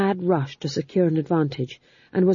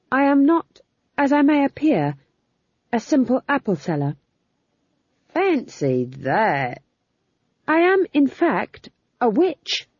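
put-on higher, deliberate young witch; Magrat's declaration; 8 semitones higher than usual. Magrat tells the guards she's a witch, high and deliberate. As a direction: announce it like a solemn threat.

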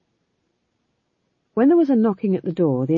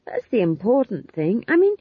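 higher, slow, emphatic protest; earnest young witch; 6 semitones higher, 3 dB louder, slower than usual. A narrator's reading. Young witch answering Granny about witches' word, earnestly. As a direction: argue the point with a raised voice.

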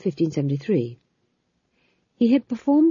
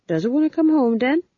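higher, louder sing-song coaxing; hopeful coaxing; 8 semitones higher, 3 dB louder than usual. Acted. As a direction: coax the cat home hopefully. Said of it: "said the fool hopefully".

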